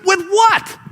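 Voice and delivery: high, incredulous "With what?"; exasperated disciples. Acted. Disciples snapping back at Jesus, high and exasperated. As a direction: throw it back in disbelief. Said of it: "out of their minds annoyed".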